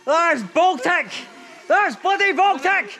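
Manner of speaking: high, slow shocked shout; shouting from the cold. Real, not acted. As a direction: yell it out, stunned by the cold. Jumping into freezing water.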